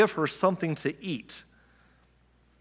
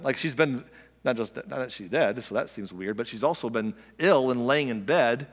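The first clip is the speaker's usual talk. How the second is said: usual speaking voice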